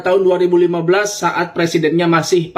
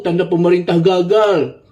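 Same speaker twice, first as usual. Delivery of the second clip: ordinary delivery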